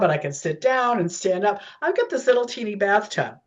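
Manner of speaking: normal talking voice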